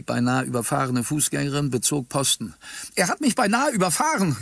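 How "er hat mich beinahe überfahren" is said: high, shrill crone's outcry; shrill old woman. A narrator's reading. Pedestrian accusing the driver to the camera, shrill old woman. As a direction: cry out like an aggrieved old woman. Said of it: "rief sie mit schriller Altweiberstimme".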